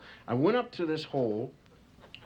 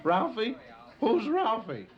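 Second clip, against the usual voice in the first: surprised tiger; 8 semitones higher, slower than usual. Acted.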